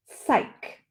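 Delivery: "Psych." whispered with no voice, ending on k; a soft unvoiced "Psych."; whispered word. Shown as a demonstration. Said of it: "this ch is a k".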